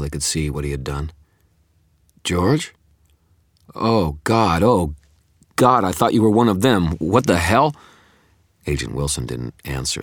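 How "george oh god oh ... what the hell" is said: high, loud, distressed exclamations; horrified outcry. A narrator's reading. Harris realizing he shot George, horrified outcry. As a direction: cry out as the mistake sinks in.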